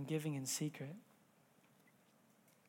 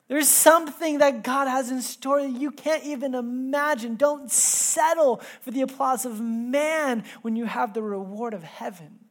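preaching at full force; 7 semitones higher, 8 dB louder than usual. Acted. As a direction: drive the call home from the pulpit. Preaching climax.

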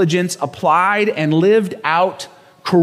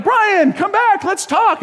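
retold calling after; slower than usual. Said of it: "and I'm like".

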